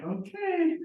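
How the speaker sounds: high, drawn-out doubtful okay; dubious acceptance. Retold or imitated. Answering the agent who will take chances, dubious.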